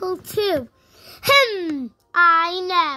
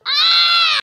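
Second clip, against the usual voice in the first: scream; 8 semitones higher, 4 dB louder than usual. Pretend. Victim screams at caller.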